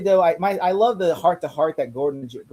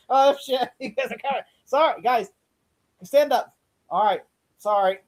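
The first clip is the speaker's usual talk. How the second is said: laughing Butch imitation; 8 semitones higher than usual.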